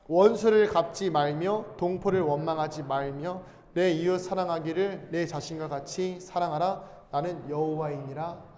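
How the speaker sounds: slow, measured recitation of scripture; scripture reading. Acted. Pastor leading the congregation in a slow scripture reading. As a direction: read it aloud slowly, like reciting the Bible together.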